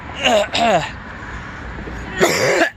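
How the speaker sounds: harsh cough that hurts; coughing through collarbone pain; painful cough. Real, not acted. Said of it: "then when I cough".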